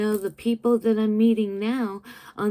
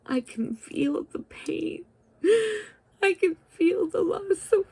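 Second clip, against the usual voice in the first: whispered then breaking into high tearful cries; crying; 8 semitones higher than usual. Real, not acted. Admitting to viewers she feels the pain, crying. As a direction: let the pain crack through the whisper. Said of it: "I don't apologize for getting emotional".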